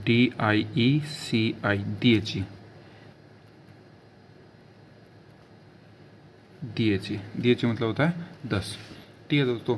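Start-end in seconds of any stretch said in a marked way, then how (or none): 0.1-1.8 D I E C I spelt slowly; slow dictation; slow spelling. Shown as a demonstration. 1.8-7.2 दिएची as dee-eh-chee; teacher repeating दिएची; Italian dieci. Shown as a demonstration. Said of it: "दस को बोला जाता है दिएची".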